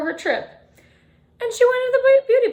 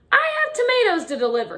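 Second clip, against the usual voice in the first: higher, louder and fast; shouting truck driver; 8 semitones higher, 5 dB louder, faster than usual. A narrator's reading. Truck driver shouting back over the honking horns. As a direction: fire it off like an angry trucker. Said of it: "he shouted".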